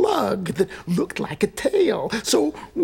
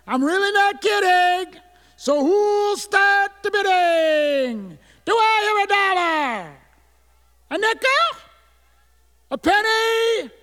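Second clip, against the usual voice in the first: pleading auctioneer; 10 semitones higher, 4 dB louder than usual. Acted. Kid begging bids for his sister, high auctioneer's call.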